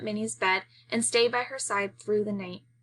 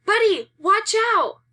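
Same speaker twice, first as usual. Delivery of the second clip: much higher, much louder warning shout; yell; 12 semitones higher, 8 dB louder than usual. Acted. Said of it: "then yells".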